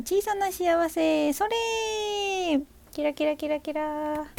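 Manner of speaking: high, very slow sing-song, drawn out; playful sparkle spell. Acted. Streamer sprinkling tiny happiness on her listeners, high drawn-out sing-song. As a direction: cast it like a fairy sprinkling glitter. Sending little happiness to viewers.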